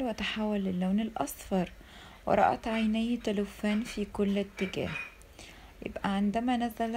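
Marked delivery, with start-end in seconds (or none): none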